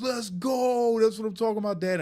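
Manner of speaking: high excited shout of the son; hyped-up son. Retold or imitated. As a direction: shout it like a hyped teenager cheering dad on. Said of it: "He goes".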